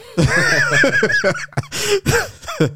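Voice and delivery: shrieking high laugh; loud shouted laugh. Real, not acted. Laughing shout after tuna joke.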